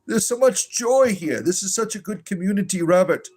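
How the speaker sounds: syrupy, sing-song reassurance, hammed-up; mock pastoral comfort. A mock pastor comforting Robert, syrupy and over-sweet. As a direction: lay the fake sympathy on thick like a gushing pastor.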